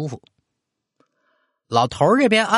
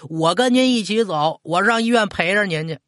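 higher, lightly voiced small boy; insistent child; 7 semitones higher than usual. A narrator's reading. Grandson refuses to let grandmother go, mildly insistent child. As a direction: insist like a clingy kid. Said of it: "孩子就不干了".

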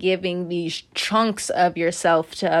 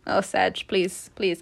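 normal talking voice

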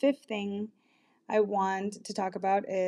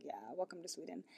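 softer, quick deadpan aside; dry sarcasm; 3 semitones lower, 15 dB quieter, faster than usual. Retold or imitated. Telling the guy nothing's open in Sweden, dry and sarcastic. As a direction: toss it off with dry irony. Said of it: "And I was like".

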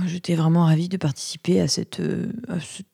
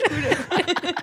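ordinary delivery

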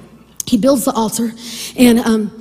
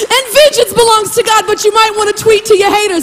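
high, sustained preacher's pitch; fired-up preaching; 7 semitones higher than usual. Real, not acted. Preacher telling congregation about their haters, fired up. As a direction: keep the intensity up, pitched high.

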